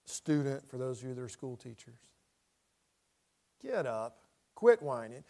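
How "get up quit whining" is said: low scolding command; stern parental order. Acted. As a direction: drop the pitch and lay down the law. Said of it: "had to say that to a child or a grandchild".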